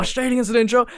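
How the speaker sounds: mock stunned meme reaction, pitch shooting up; shocked disbelief.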